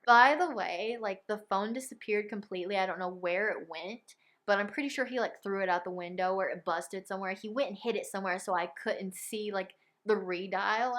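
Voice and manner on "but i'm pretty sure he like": normal talking voice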